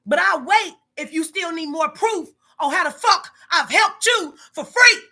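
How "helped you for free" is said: slow, strained, higher cry; voice cracking. Real, not acted. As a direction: let it break into a sob. Boss venting, voice cracks.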